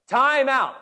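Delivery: high, loud yell for a timeout; shouting timeout. Retold or imitated. Coach screaming for a timeout, high loud yell. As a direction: scream it across the court. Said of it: "as loud as I possibly can".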